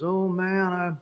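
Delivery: a hint of Billy Joe hesitating to cancel; Billy Joe backing out. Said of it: "And he goes".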